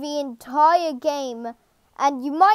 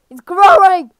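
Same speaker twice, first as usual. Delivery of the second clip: louder, urgent cry of alarm; rising alarm; 3 semitones higher, 8 dB louder than usual. Real, not acted. Player watching the game boss grow, alarmed and loud. Repeats as the boss keeps growing.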